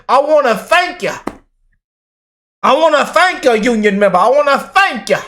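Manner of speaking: high-pitched imitation of a drunk lady. Vlogger quoting a lady thanking union members, drunk lady impression. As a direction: mimic a drunk woman thanking everyone. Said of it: "that lady that was drunk as hell".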